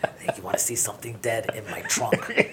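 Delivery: whispered, sinister offer; creepy whisper. Shown as a demonstration. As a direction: tempt them in a hushed creepy tone. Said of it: "give it a little bit of deeper".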